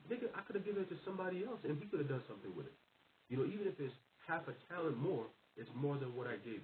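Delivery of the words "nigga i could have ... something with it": raised-pitch exasperated outburst; pissed off. Acted. The Lord is pissed at the servant, high exasperated rant. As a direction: vent the master's annoyance high. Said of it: "the Lord's gonna be pissed at that".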